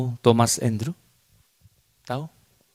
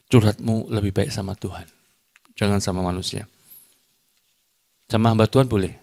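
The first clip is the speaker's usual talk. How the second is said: normal talking voice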